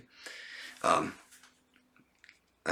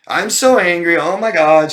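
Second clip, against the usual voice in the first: much higher, much louder, overacted fake rage; mock fake anger; 9 semitones higher, 12 dB louder than usual. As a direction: shout it like an outburst nobody should believe. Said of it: "you aren't even trying to convince me".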